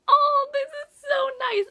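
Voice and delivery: a fan swooning over the book's romantic ending; gushing. Retold or imitated. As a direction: gush over the sweet ending. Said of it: "And I was just like".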